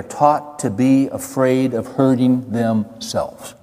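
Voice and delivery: slow and deliberate, each word weighed; slow, weighty. Acted. Pastor drives home his warning, slow and deliberate. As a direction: let the point sink in slowly. Slowing for emphasis.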